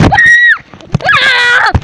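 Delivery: loud, high pretend scream; mock scream. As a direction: scream as if the cat attacked. Pretend attack by the cat.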